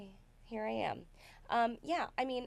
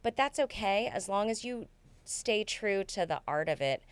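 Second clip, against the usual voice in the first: ordinary talk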